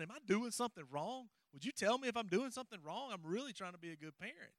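high, worried plea to his dad; retelling a nervous plea to his father; anxious pleading. Retold or imitated. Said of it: "and I was like".

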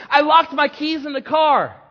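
loud, high, frantic outburst; blurted out. Acted. Frazzled mother phoning her son, blurting loudly. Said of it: "she blurted out".

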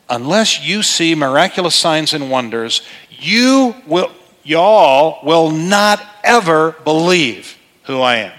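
slow emphatic declaration on "y'all" and "ever"; strong statement. Shown as a demonstration. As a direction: state it firmly, not as a question. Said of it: "here's actually what he said".

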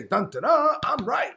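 high, sing-song gloating; mock self-righteous. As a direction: parody a smug church member. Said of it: "they wanna say".

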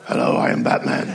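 fast gravelly-free whispered Batman; hammed-up superhero growl, whispered. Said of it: "That wasn't a very good voice".

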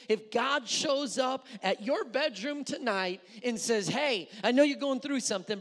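loud, rising preacher's call; preaching at full force. Acted. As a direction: press the question on the crowd.

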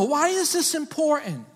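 normal talking voice

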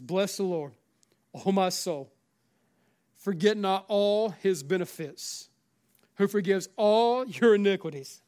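loud, slow, emphatic psalm reading; scripture declamation. Acted. Preacher reciting a psalm to his congregation, declamatory. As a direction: proclaim each phrase with long stressed words.

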